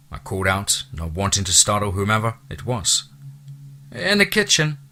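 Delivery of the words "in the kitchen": 高声呼喊的女人; calling woman. Acted. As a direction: call back from another room, high. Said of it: "a woman's voice called back".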